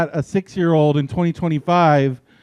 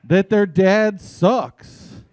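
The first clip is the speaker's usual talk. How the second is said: slow, each word laid out; slow and pointed; 3 semitones higher, much slower than usual. Retold or imitated. Comic answering the critics, slow and pointed. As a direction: spell it out slowly for the slow ones. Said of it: "I'm like".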